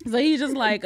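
normal talking voice

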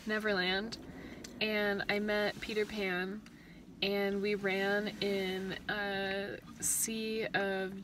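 slow, soft, dreamy storytelling; drawn-out intimate. Real, not acted. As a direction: draw each word out softly. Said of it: "just drawn out and intimate".

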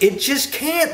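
high-pitched imitated protest; mimicked objectors. Townspeople rejecting the teaching, mimicked in protest. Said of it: "And they'll say it just".

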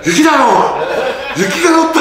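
retold high-pitched exclamation; excited cry. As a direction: act out amazement at first snow. Acted cry on seeing first snow.

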